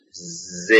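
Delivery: soft isolated "ز", a brief buzz; bare Z sound. Shown as a demonstration. Teacher showing the sound that comes out, bare Z. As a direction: let "ز" buzz quietly on its own. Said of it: "یه صدای ز".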